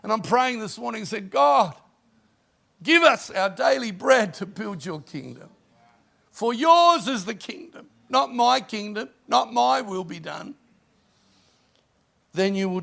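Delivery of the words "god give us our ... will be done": fervent prayer. Acted. Prayer at full force.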